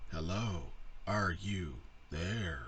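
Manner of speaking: slow, low mock computer reply. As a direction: drone it like an old speech chip. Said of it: "And it'd be like".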